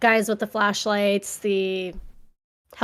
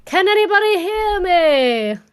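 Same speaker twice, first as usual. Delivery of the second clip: Titanic quote; 7 semitones higher than usual. Retold or imitated. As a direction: call out like a lost survivor. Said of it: "Name that movie".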